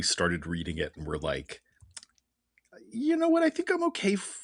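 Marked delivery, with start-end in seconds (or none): 2.9-4.4 high-pitched mock reader voice; squeaky imitation of a fed-up reader. Hosts mocking a fed-up comic reader, high and squeaky.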